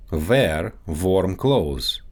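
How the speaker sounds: Russian accent, "Wear warm" said as "vear varm"; Russian accent, slow, with V in place of each W. Shown as a demonstration. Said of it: "W and V sounds".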